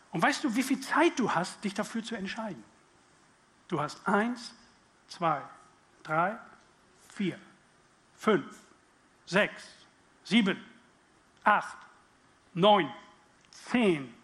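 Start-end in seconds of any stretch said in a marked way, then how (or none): 3.7-14.1 each number louder than the last; building countdown. Acted. Preacher counting the seconds, growing louder. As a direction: count the seconds up to a near shout.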